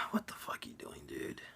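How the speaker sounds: whispered disbelief under the breath; exasperated whisper. Retold or imitated. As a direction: hiss it like you're face palming. Face palming at dumb character.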